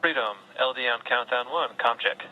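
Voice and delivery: astronaut radio. Real, not acted. Astronaut radio comm check.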